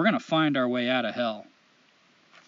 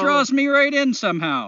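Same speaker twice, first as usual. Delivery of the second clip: higher, exaggerated Canadian lilt; Canadian accent; 7 semitones higher, 3 dB louder than usual. Host reading a listener's letter aloud, hammed-up Canadian accent. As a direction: put on a chipper, apologetic Canadian accent. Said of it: "in the best Canadian accent I know".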